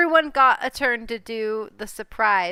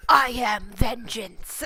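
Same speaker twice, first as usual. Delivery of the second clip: Batman growl; slower than usual. Acted. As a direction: growl it like the Dark Knight. GM voices Batman line.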